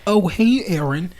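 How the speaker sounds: high, loud bubbly hello; squeaky cheerful girl greeting; chirpy greeting. Pretend. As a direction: greet him like an excited schoolgirl. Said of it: "Yui's just like".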